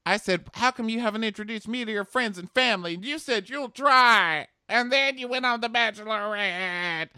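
high-pitched hammed-up rant, stretching the ends; exaggerated screechy grievance; mock hysterical complaint. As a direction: wail the accusation up high, imitating an aggrieved ex. Acts out the girl's furious complaint.

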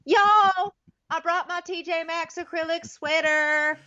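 high, gushing mock Southern voice; hammed-up imitation of an over-eager girlfriend; Raven. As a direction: show off to the family in a put-on high voice. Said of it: "Raven, whoa, calm down over there".

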